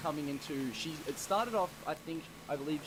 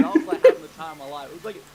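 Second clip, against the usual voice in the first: louder, laughing through the punchline; 3 semitones higher, 19 dB louder than usual. Real, not acted. Boasting to friends about the dance, laughing loudly. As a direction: crack up as you land the joke.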